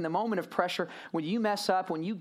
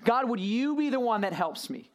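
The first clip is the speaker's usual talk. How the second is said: pleading prayer; 5 semitones higher than usual. Retold or imitated. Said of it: "and he says".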